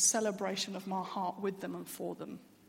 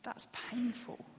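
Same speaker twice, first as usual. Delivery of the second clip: softer, half-whispered hurt, to herself; inner pain; 6 dB quieter, much slower than usual. Retold or imitated. Her private reaction to the news: hushed, whispered hurt. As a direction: let the pain slip out under the breath. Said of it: "on the inside, I was just like".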